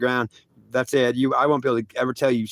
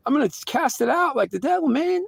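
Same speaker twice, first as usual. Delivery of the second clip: high-pitched frantic outburst; frantic outcry; 12 semitones higher than usual. Retold or imitated. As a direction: act out a frantic man, voice pitched way up. Acted frantic inner struggle.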